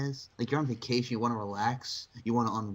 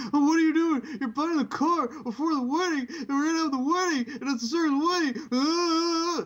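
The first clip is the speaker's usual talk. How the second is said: shrill mocking nag, much higher and louder; whiny mock girlfriend voice, pitched way up; mocking Lana; 5 dB louder than usual. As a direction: mimic a nagging fiancée, high and loud. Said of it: "and you like".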